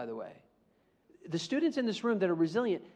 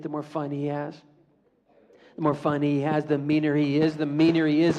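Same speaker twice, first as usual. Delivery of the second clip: ordinary delivery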